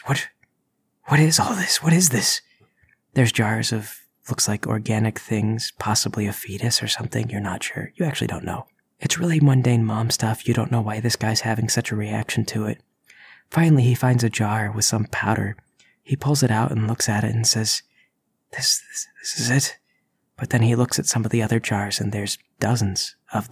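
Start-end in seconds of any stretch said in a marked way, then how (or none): 0.0-2.4 frightened whisper. Acted. Intruder panics over jars. 18.5-19.8 stammering awed whisper; hushed whisper. Acted. Intruder whispering over the jar of powder he came for.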